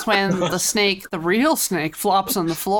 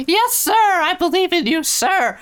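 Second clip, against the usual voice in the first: higher, louder, earnest yes-sir; eager high-pitched girl; 10 semitones higher, 4 dB louder than usual. Retold or imitated. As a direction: chirp it out like a devoted girl. Voicing Sanae, high and eager.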